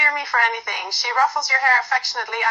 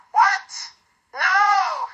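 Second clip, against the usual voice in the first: much higher, startled outburst; horrified; 8 semitones higher than usual. A narrator's reading. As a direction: gasp it out in mock horror. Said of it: "Fridolina's eyes widen in horror".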